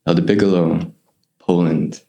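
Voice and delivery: low, solemn Australian announcement; Australian accent. Retold or imitated. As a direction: read the names out in a low Australian voice. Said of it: "big, mean Aussie".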